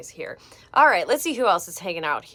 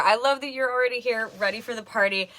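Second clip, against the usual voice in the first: ordinary delivery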